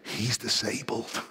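hushed, slow stage whisper; whispering child. Acted. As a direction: confide it softly in someone's ear. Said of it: "one of the kids whispers in his ear".

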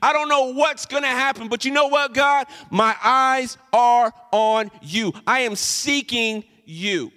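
pleading prayer, rising high and loud; earnest pleading prayer, slowing at the end; voicing a desperate prayer. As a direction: cry out to God, then slow to a hush of trust. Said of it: "come to a place like Jehoshaphat did and say".

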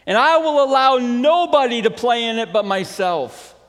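loud, high possessive giant; storybook yell staking a claim; acted yell. Giant claiming the garden as his own, acted yell. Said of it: "he yelled".

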